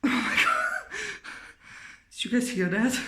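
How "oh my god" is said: high, shaken "oh my God"; frightened gasp. Real, not acted. Startled by sudden noise.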